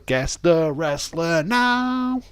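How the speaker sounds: drawn-out sing-song "Guess the wrestler now"; sing-song prompt. Acted. Streamer reminding chat of the game in a sing-song voice.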